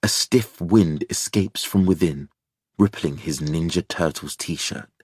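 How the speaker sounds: ordinary delivery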